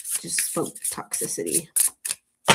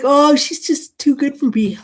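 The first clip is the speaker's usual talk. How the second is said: mock insecure; 7 dB louder than usual.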